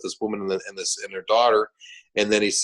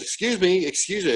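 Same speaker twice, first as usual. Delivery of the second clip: higher-pitched retold interjection; calling for attention; 9 semitones higher than usual. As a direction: cut in politely but urgently.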